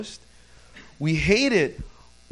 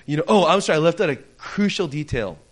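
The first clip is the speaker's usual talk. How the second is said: usual speaking voice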